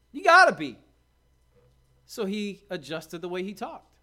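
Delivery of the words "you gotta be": high, shouted insistence; loud mock accusation. Bystanders pressing Peter that he is a Galilean, loud accusation.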